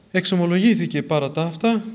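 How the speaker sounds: usual speaking voice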